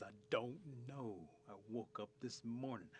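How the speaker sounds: slow, drawn-out turtle voice. Acted. Turtle answering Rabbit about running, slow and puzzled. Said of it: "Turtle says".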